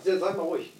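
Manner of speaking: gedämpft, fast beiseite gesprochen; leise Bitte. Real, not acted. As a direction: leise die anderen zum Schweigen bringen. Telefon klingelt, bittet um Ruhe. (leise, während er ans Telefon geht)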